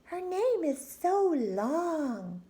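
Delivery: high, slow drawn-out mockery; snide classmate. A narrator's reading. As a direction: stretch it out teasingly. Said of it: "said Jo".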